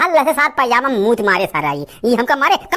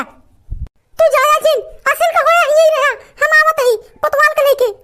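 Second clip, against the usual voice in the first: high, nagging village wife, scolding; wife scolding husband to fetch the free rations; put-on wife voice. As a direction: nag your lazy husband from up high in a woman's voice. Wife threatens police.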